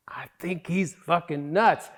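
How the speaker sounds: unvoiced whisper aside; conspiratorial whisper. Real, not acted. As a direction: whisper it behind his back. Aside about the therapist.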